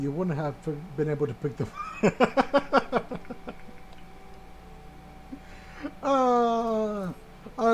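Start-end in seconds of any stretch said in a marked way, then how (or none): 1.8-5.9 silent breathy wheeze of laughter; high, airless wheezing laugh. Real, not acted. Laughing at fourth wall break.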